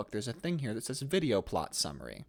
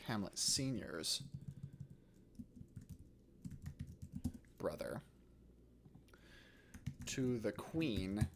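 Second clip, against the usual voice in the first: slow, halting dictation with long pauses; composing aloud; 10 dB quieter, slower than usual. Real, not acted. Teacher drafting a play summary for students, slow dictation. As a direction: dictate softly to yourself, phrase by phrase.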